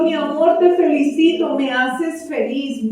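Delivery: put-on high, gushing mom praising her child; gushing praise. Parent overpraising a child, high and gushing. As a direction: lay the praise on thick and sweet. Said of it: "Es muy fi-, diferente decirlo".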